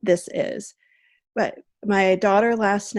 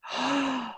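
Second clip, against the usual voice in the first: soft gasp; 4 semitones higher, 7 dB quieter than usual. Retold or imitated. Daughter gasps in story.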